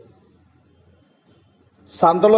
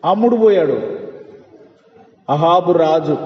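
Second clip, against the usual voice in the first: ordinary talk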